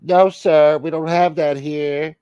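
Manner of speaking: imitated fast-food employee; drive-thru worker. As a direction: refuse the customer, slowing at the end.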